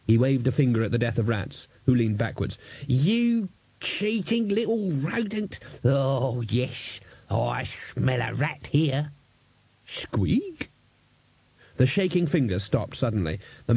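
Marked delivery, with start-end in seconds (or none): 2.9-9.1 put-on high, slow, wagging old codger; old man scolding the rat; accusing old man. 9.8-10.7 high rising rat squeak; questioning squeak. Acted.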